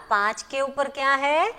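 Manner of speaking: usual speaking voice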